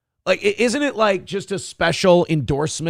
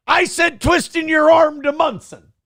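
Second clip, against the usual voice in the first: shouting; 12 semitones higher than usual. Real, not acted. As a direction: yell it across the room. Sudden shout at someone.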